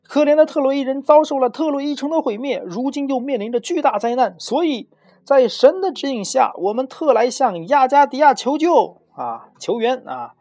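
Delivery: very high, loud plea shouted from afar; high-pitched heroic shout; loud declamatory call. A narrator's reading. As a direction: hail the castle guards and beg for help. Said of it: "向城堡里问话的守卫高声喊道啊".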